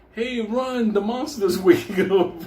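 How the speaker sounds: high laughing mock alarm; panicked mock call to run; mock warning shout. Imagined warning to passengers.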